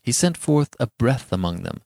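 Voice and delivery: ordinary delivery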